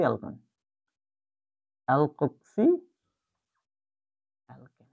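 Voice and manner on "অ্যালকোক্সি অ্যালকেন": split into parts. Shown as a demonstration. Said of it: "উচ্চারণটা হবে".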